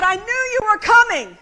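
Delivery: lightly voiced village grandmother, emphatic; old lady insisting. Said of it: "And she said".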